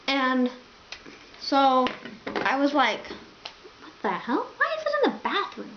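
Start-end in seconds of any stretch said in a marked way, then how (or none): 3.8-5.7 high-pitched baffled questioning; mock bewildered inner voice; confused. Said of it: "I was just really confused".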